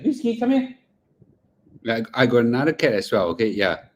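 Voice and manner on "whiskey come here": high coaxing call to the cat. Real, not acted. Owner calling his second cat over, high coaxing voice. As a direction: pitch up sweetly to call Whiskey over.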